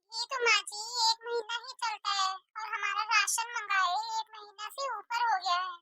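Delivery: ordinary talk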